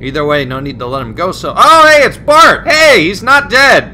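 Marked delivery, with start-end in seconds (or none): 1.6-3.8 surprised delight. Real, not acted. Surprised to see Bart alive.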